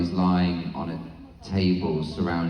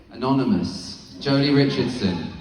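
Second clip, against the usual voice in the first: usual speaking voice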